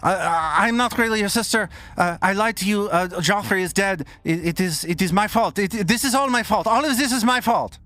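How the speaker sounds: high, rushed, panicky admission; frantic confession. Acted. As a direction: spill it out fast in a rising panic.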